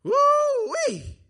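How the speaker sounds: high, loud hillbilly whoop; drawn-out falsetto holler; Jethro. Retold or imitated. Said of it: "reminds me of the Beverly Hillbillies when Jethro would say".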